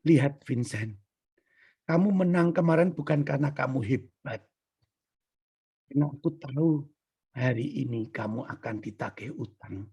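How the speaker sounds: low, soft, measured divine voice; Jesus. Retold or imitated. Lord gently corrects proud young man, hushed. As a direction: speak quietly and gravely, with pauses. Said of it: "Yesus seolah berbicara".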